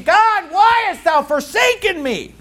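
high, drawn-out cry to God. Acted. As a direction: cry it out slowly, pitched well up. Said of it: "he's crying out saying".

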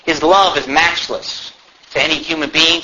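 ordinary delivery

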